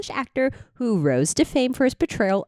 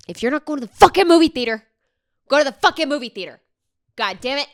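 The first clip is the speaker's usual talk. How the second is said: fast, higher, louder rant; exasperated scolding; 5 semitones higher, 6 dB louder, much faster than usual. Real, not acted. Host urging viewers to the cinema, exasperated scolding. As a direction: scold them in a loud rush.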